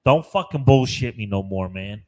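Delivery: loud, hammed-up indignation; mock outrage. Scolding his co-host over a missing car, loud mock outrage. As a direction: tell him off loudly, half joking.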